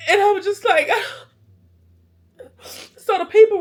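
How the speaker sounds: real tears, high and choked; breaking down crying. Real, not acted. Crash survivor overcome while telling her story, breaking down crying. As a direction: break into a sob, then push on. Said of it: "Oh, I need some tissue".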